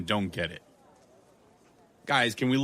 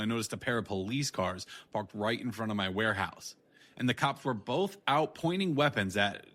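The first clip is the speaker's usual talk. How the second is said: inner narration; 5 dB quieter than usual. A narrator's reading. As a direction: tell it quietly to yourself.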